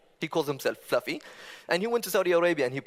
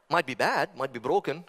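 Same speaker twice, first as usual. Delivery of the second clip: ordinary talk, nothing marked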